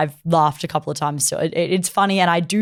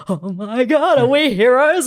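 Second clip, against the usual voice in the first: high, louder, giddy gushing as an imitated fangirl; beyond ecstatic; 8 semitones higher, 5 dB louder, slower than usual. Newly summoned girl marvelling at being isekai'd, ecstatic. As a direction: act out over-the-top delight. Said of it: "she is so beyond ecstatic".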